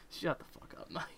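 a muttered aside you don't want heard; whispered quote. Retold or imitated. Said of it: "I'm referencing Mike from Internet Box".

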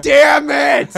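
loud, high frustrated outburst; frustrated shout. Retold or imitated. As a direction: yell it in disappointment. Disappointed by a discount.

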